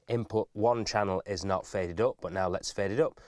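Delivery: usual speaking voice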